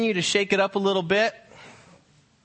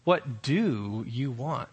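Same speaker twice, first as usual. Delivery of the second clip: low, slow, quieter, leaning on "do"; a little attitude; 6 semitones lower, 5 dB quieter, much slower than usual. Shown as a demonstration. Pastor demonstrating attitude in the question, low and slow. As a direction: drawl it with some sass. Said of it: "Or give a little attitude".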